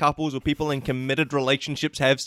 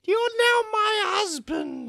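very high, slow shouted claim; Candy monster shout. Acted. Candy claiming the Aussie as husband, high slow shout. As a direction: bellow it like a monster seizing her prey.

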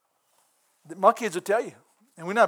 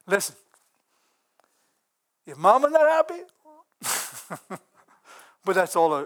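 ordinary talk, nothing marked

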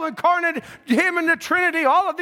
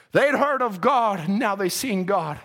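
ordinary talk

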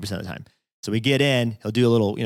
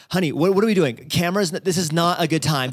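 high, loud mock wife complaining to her husband; hammed-up protesting housewife; exasperated wife; 8 semitones higher, 4 dB louder than usual. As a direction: whine it out like a put-upon wife.

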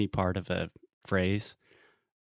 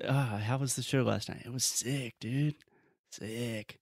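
casual dude voice; 3 semitones higher, 5 dB quieter than usual. Retold or imitated. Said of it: "We say".

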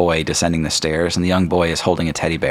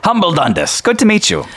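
high, loud hearty dwarf voice; jolly dwarf; 13 semitones higher, 5 dB louder than usual. Acted. Dwarf introduces himself to the party, loud and high. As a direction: greet them like a cheerful old dwarf.

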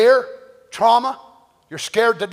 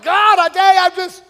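crying out to God; 8 semitones higher, 6 dB louder than usual. Retold or imitated. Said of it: "go before the throne of God and say".

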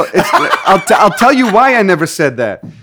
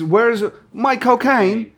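put-on slow English accent, shouted; Michael Caine impression; 6 semitones higher, much slower than usual. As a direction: do Michael Caine asking for cocaine. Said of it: "Michael Caine in English".

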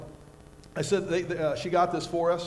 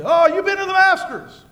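high, loud, impressed gasp of a question; impressed surprise; 11 semitones higher, 10 dB louder than usual. Retold or imitated. A friend asks about the Masters cup, impressed and surprised. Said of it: "So people say".

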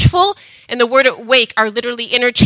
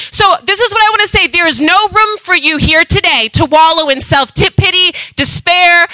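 normal talking voice

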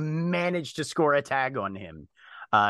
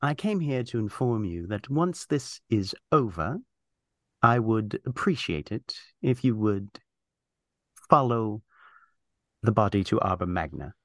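low, measured, deliberate voice with pauses; stern formal character; 3 semitones lower than usual. Acted. Character instructs Scion about the body, stern and formal. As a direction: give grave orders, lowered and deliberate.

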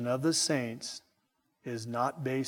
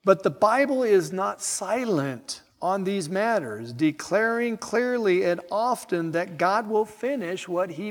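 raised, loud pulpit proclamation; forceful preaching; 8 semitones higher, 5 dB louder, slower than usual. Acted. Preacher assuring his congregation from scripture, forceful high-pitched proclamation. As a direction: lift the voice and proclaim it with conviction.

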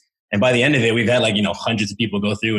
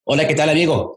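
plain Spanish greeting, a touch higher; Spanish rhythm; 4 semitones higher, faster than usual. Shown as a demonstration. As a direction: say it with normal Spanish timing. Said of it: "So I'm speaking Spanish".

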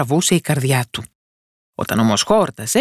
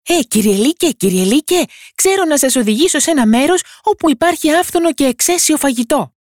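high, loud little voice coaxing the wolf; small boy calling up cheerfully, much higher; tiny boy calling; 12 semitones higher, 4 dB louder than usual. A narrator's reading. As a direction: call out to the wolf from inside his belly. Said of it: "άρχισε να του φωνάζει".

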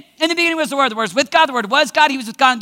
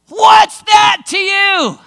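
slow, loud snarled retort, higher pitch; ticked off Jesus; 5 semitones higher, 5 dB louder, much slower than usual. Acted. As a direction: snap it back like a rebuke.

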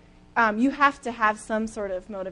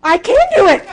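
rallied runner; 10 semitones higher, 13 dB louder than usual. Retold or imitated. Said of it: "he's like".

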